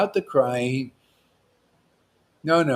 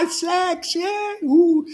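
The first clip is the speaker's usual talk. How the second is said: hammed-up ecstatic cry; much slower than usual. Preacher joking about sex with a hammed-up ecstatic cry. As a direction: ham up a cry of pleasure.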